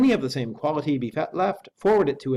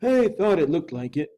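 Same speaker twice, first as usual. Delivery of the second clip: sulky; 4 semitones higher, 3 dB louder than usual. A narrator's reading. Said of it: "said the servant rather sulkily".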